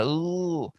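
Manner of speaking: soft, high mock ooh of a singer, said a second time; imitated singer's ooh. Shown as a demonstration. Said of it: "you didn't have as much of that".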